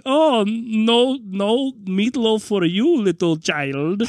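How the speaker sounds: slow, high-pitched mock German accent. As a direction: draw it out like a comic German henchman. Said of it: "that was a great German accent".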